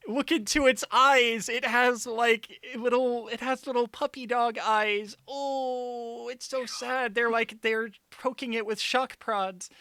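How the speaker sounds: high, loud, sappy mock pity; gushing high mock-sad voice. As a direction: coo sarcastically over the poor creature. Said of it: "hold on".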